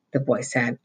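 usual speaking voice

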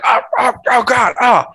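very high, squealed cries of pain; mock pained cries. Boy being maced by the killer, squealing pain. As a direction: yelp like the boy getting maced.

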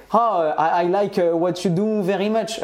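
mock broken English with a French accent; clumsy French English. Mimicking fans with poor English.

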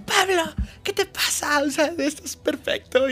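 very high-pitched mock screech of outrage; retold yelling. Ex-girlfriend confronting him from the escalators, mock yelling. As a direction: imitate an ex yelling across a food court. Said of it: "aquí llegó gritándome".

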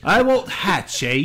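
slow, drawn-out fake Australian-Cockney accent; mock Cockney accent. Co-host echoes the band name, in mock Cockney accent. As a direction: play along in a badly faked Cockney. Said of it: "It's in some Cockney accent".

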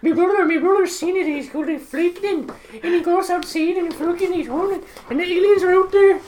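high, loud mock Irish rant; exaggerated comic Irish voice; mock Irish accent. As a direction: ham up an Irish local, pitched high and loud. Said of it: "he's like".

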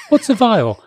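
asked through real laughter; cracking up mid-line; laughing. Real, not acted. Reader cracks up.

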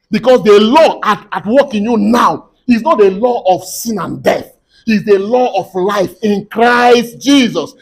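loud, emphatic sermon delivery; forceful preaching. Real, not acted. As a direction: drive each phrase home to the congregation.

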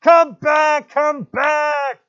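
very high, very loud desperate scream. Acted. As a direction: scream after the vanishing ship. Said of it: "screamed at the ship".

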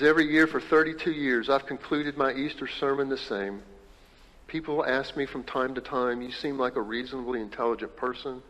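loud preaching read of the quote. Acted. Pastor reading Adam Hamilton's words to the congregation, loudly. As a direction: read it out to the hall.

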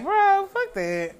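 disgusted. Retold or imitated. Reacting in quoted inner voice.

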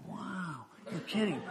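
high, hushed amazement; awed class. Retold or imitated. Class hearing of the sick chief, awed. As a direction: imitate a wowed class, soft and high. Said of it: "the class was like".

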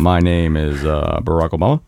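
deep, halting presidential voice; Barack Obama. Acted. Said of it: "If you get a little deeper".

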